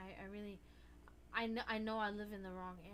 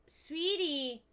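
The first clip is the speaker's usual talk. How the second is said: calling out; 5 semitones higher, 7 dB louder than usual. Real, not acted. Calling the cat.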